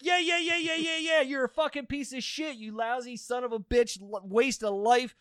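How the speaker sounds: high-pitched retold tirade; unloading on him. Cussing out the phone scammer, unloading. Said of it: "And I just unloaded on him".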